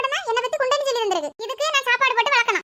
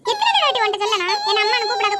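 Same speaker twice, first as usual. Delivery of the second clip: normal talking voice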